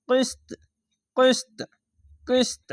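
high bouncing qalqalah on কিস্ত; qalqalah bounce. Shown as a demonstration. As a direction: kick the ত of কিস্ত with a jolt. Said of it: "এরকমভাবে ধাক্কা মেরে কলকলাটি হবে".

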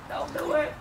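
ordinary delivery

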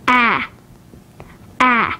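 short "A" as in mat; isolated "A", the vowel of hand; short vowel demo. Shown as a demonstration. Teacher showing learners the short vowels, "A" as in mat. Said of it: "Take a look at the short vowels".

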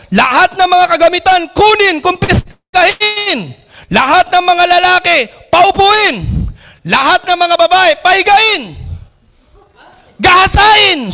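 hold-up man yelling at everyone; robber's shout. Acted. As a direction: bark orders at the terrified passengers. Hold-upper giving orders.

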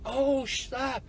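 high, soft, drawn-out wonder; acted-out stunned reaction; quiet amazement. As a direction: let it out in hushed disbelief. Retold amazement at purchases.

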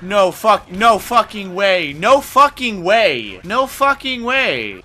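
loud, high outraged disbelief; worked-up gamer outburst; triggered. Real, not acted. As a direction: stretch each repeat out in loud protest. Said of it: "I'm, I'm hella triggered".

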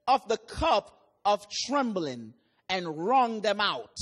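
measured, solemn preaching read; scripture reading. Acted. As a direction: pulpit reading of Isaiah. Reads scripture aloud in sermon.

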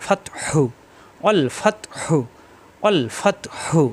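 "হু" pushed from a tightened throat; pressed throat ha. Shown as a demonstration. Teacher demonstrates ha, "হু" pressed from the throat. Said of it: "কণ্ঠনালীতে চাপ দিয়ে".

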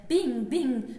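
two ringing bings, each falling in pitch; loud imitated bing. Poet falling off the shelf, loud imitated impacts. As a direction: punch out the bings like bumps. Imitates bouncing falls in poem.

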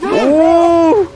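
high shouted cheer; excited shout. Real, not acted. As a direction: yell the save like a fan. Celebrating a save in game.

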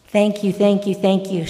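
mild shout, loud first then easing; shouted thanks. Acted. Healed man thanks Jesus, mildly shouted. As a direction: call out repeated thanks, half-shouted. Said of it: "shouted the man".

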